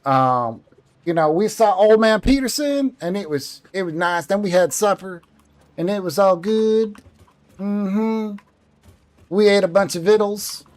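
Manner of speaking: high, folksy Southern country twang. Shown as a demonstration. Southerner telling about a visit with Ma, high country twang. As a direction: tell it like down-home Southern kin. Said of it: "the different southern twangs".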